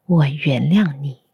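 usual speaking voice

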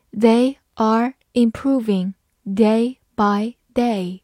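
slow, each word apart; slow reading. Shown as a demonstration. Teacher reading slowly for students to follow. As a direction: read it slowly for learners. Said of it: "好，慢慢来读".

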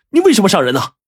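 high-pitched, startled accusation; shocked. A narrator's reading. Young man confronting the attacker who felled his friend, shocked. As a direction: demand it in alarm, voice jumping up. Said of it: "小三子大吃一惊啊".

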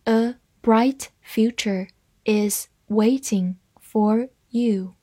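slow and separated, word by word; teacher's slow model sentence; slow reading. Shown as a demonstration. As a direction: leave a gap after every word. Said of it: "慢慢来读".

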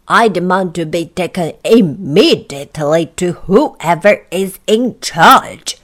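loud, indignant demand; loud, imperious outrage; angry. Acted. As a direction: demand it loudly and angrily, slowing at the end. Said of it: "said Mister Uppity angrily".

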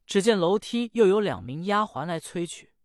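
ordinary delivery

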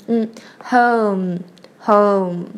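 HOME slow, closing on a hummed m; hum, then HOME with lips closed at the end; nasal ending. Shown as a demonstration. Teacher shows the final nasal, nasal ending. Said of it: "最后把嘴巴闭起来发嗯这个鼻音".

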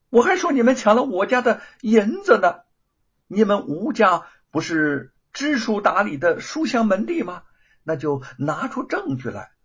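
loud, high, cold sneer; sneering retort. Acted. The thief taunts the sisters, cold sneer. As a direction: taunt them to prove it in court. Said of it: "何莲冷笑着说".

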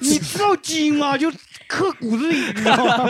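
laughing mock Northeastern tirade; mock Dongbei rant. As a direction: drag out the gene lecture like a rough Northeasterner. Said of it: "你要不要来一句东北那个".

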